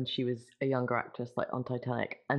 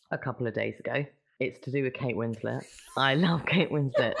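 ordinary talk, nothing marked

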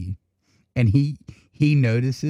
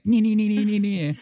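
higher chirpy nee sounds; imitated bell ringing; 9 semitones higher than usual. Imitating the bird's bell toy, high and chirpy. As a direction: mimic the bird shaking her bell. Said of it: "and went".

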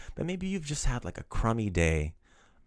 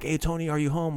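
calling at the door; 7 semitones higher than usual. Acted. Pretend knocking on Tony's door.

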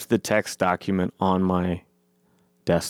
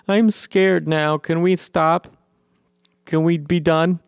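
mock scared plea; 9 semitones higher than usual. Mocking agitator now pleading scared.

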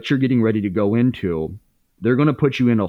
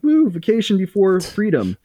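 mock celebration; 10 semitones higher than usual. To himself while his partner is in jail, a high gleeful whoop. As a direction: cheer it like a party is starting.

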